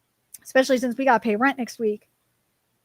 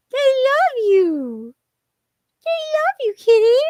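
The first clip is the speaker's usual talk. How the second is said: gushing pet-talk; 10 semitones higher, 6 dB louder than usual. Real, not acted. Streamer tells the cat viewers love it, high gushing baby talk. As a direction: squeal it sweetly at a pet.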